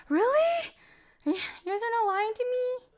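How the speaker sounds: high, disbelieving squeal; can't believe the compliment; surprised. Real, not acted. Chat praises her dancing.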